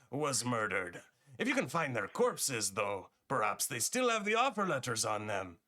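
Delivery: high, swooping pirate merchant; attempted Russian accent sliding into pirate; Russian-turned-pirate accent. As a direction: play a pirate dealer, high phrases falling away in a botched Russian accent. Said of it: "I lost Russian and got to pirate".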